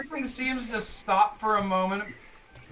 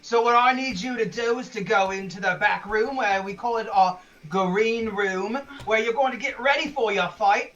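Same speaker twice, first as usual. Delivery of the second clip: Fritz, officious arena boss; 4 dB louder than usual. Acted. Arena boss sending fighters to the green room, officious. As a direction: rein them in and explain the house rules.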